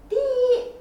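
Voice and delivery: ordinary delivery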